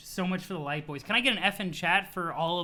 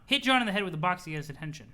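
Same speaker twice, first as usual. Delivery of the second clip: ordinary delivery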